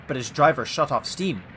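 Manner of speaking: ordinary delivery, nothing marked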